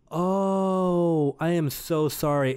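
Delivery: drawn-out, hammed-up sorry; embarrassed apology. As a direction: imitate a teacher realizing the mistake. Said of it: "that anger turned into embarrassment".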